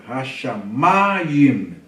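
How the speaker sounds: Hashamayim with MA long and loud; very slow Hashamayim, leaning hard on "ma"; stressed syllable shown. Shown as a demonstration. Teacher showing the tonic syllable in Hashamayim, stress drawn out on MA. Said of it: "Ó a sílaba tônica".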